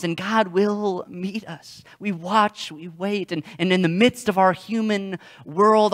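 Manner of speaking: normal talking voice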